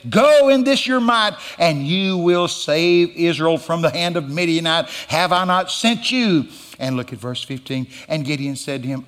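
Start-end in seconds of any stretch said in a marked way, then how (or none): 0.1-6.5 raised, ringing divine command; commanding proclamation. Acted. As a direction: send him off with authority, voice lifted. The Lord's command to Gideon, acted.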